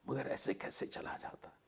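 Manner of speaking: soft unvoiced whisper to himself; hushed inner voice wondering whether to leave; whispered thought. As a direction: muse it under your breath. His own thought while waiting, dozing.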